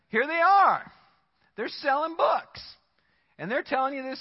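loud, high outburst; exclaiming. Real, not acted. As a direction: call it out in indignation. Preacher exclaims.

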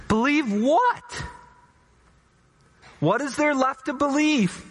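high, strained voice of a desperate father; despairing ruler. Acted. The grieving ruler answers Jesus, high and despairing. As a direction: cry it out, hope gone.

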